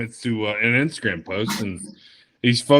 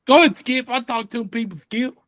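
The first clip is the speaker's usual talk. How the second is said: much higher, goofy put-on voice; high silly voice; 11 semitones higher than usual. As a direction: squeak it out like a cartoon.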